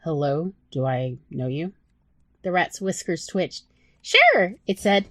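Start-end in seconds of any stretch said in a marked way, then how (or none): 0.0-1.7 lower, guarded man; a touch lower, cautious Bob; wary Bob. Acted. Voices Bob. 4.0-4.6 much higher, bright squeaky rat; easy, breezy yes; high little rat voice; chirpy rat. Acted.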